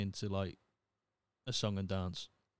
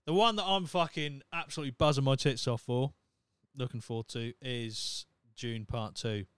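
ordinary talk, nothing marked

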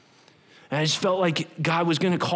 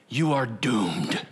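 voice of lies; 5 semitones lower than usual. Acted. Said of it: "The voice of lies".

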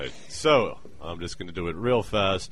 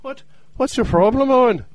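character voice; 8 dB louder than usual. Acted. O'Neill voice to Owen.

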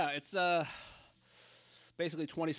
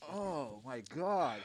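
pained groan; much slower than usual. Real, not acted. Suffering chip heat.

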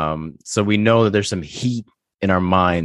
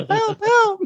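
mock well cry.

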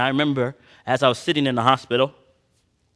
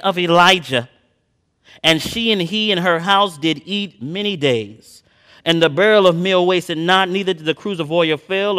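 loud, high, ringing pulpit delivery; preaching at full force; 6 semitones higher, 4 dB louder than usual. Acted. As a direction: preach it to the congregation.

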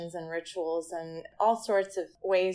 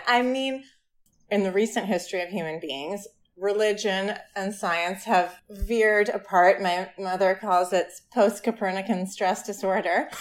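ordinary delivery

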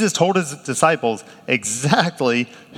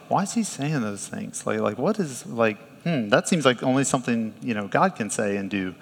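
much softer, musing to yourself; inner thought voice; 3 semitones lower, 7 dB quieter than usual. Retold or imitated. Listener in the crowd thinking privately, soft musing. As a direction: murmur it like a thought in your head. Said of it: "the thought bubble in your head".

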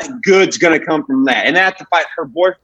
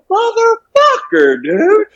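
very high, drawn-out squeal of disbelief; amazed exasperation; 3 dB louder, much slower than usual. Retold or imitated. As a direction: stretch each word out in high-pitched astonishment. Amazed that walking away works.

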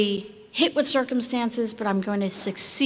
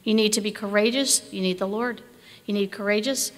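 ordinary delivery

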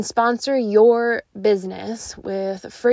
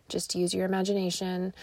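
ordinary talk, nothing marked

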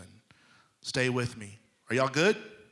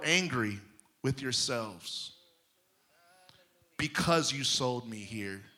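lower, softer, tender; gentle reassurance; 3 semitones lower, 5 dB quieter than usual. Acted. Joseph comforting his guilty brothers, soft gentle reassurance. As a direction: ease their guilt softly.

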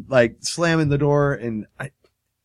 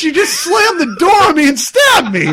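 high, loud protest with laughter in it; imitated outraged victim; 12 semitones higher, 7 dB louder than usual. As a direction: yell in mock outrage at an attacker.

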